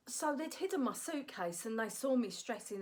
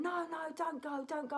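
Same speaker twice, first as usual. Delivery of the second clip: pleading crew; 5 semitones higher than usual. Retold or imitated. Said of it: "just going".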